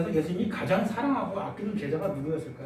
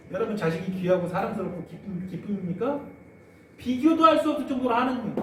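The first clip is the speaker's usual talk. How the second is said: normal talking voice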